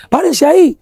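sharp, high barked command; mock policeman shouting at the fleeing men to stop; police order. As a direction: yell it like a cop with a shotgun. Police officer ordering them to stop.

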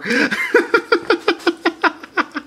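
squealing delighted laugh; real delighted laughter; high giddy laugh. Real, not acted. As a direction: let out a high giggle. Laughing at toy.

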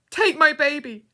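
high, very loud plea; desperate cry. A narrator's reading. Girl in labour begging a stranger, desperate cry. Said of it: "cried the lady".

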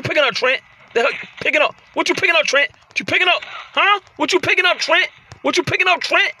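high, rapid-fire repeated demand; worked-up playful confrontation; challenging an opponent. Pretend. Challenging opponent grabbing an object.

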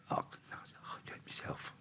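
breathy whispered aside. Retold or imitated. Aside to sister during interview, whispered. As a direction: whisper it so only she hears.

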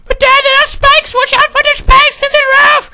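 put-on very high, loud child squeal; alarmed little son. Son warning Dad about spikes, shrill kid panic. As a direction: warn Dad in a panicked falsetto.

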